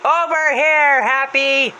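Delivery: high, loud call; Danny's voice. Retold or imitated. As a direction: holler to be found from afar. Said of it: "And Danny says".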